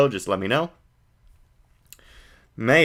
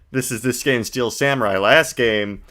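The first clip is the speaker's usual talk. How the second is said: ordinary delivery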